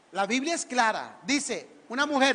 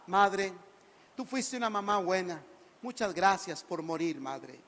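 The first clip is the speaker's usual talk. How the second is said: mock grateful son; 5 semitones lower, 4 dB quieter than usual. Son thanks dead mother mockingly.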